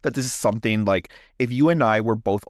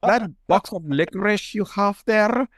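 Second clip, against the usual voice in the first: high, slow put-on German accent; hammed-up German customer, pitched high; comic German accent asking to buy; 9 semitones higher, slower than usual. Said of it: "I'm the German guy".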